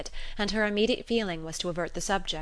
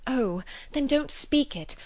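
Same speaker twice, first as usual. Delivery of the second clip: urgent, higher, slowed; eager plea; 6 semitones higher, slower than usual. Acted. Emma stopping Knightley's confession, eager. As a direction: rush to stop him, higher and slower. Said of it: "she eagerly cried".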